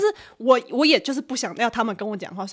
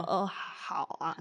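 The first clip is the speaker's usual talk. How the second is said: meek yes; 7 semitones lower, 12 dB quieter, much slower than usual. Retold or imitated. Timid reply to stranger.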